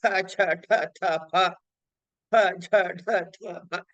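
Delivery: "క, చ, ట, త, ప" pressed with a strong breath; aspirated stops. Shown as a demonstration. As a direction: push air hard on "క, చ, ట, త, ప". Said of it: "ఒత్తి పలికేవి". (మహాప్రాణాలు ఒత్తి పలకండి)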